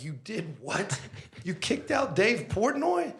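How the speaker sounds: high, loud disbelieving cry; shocked outburst. Retold or imitated. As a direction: explode in retold disbelief at the staff. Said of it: "kinda went Italian and started throwing stuff and getting really upset".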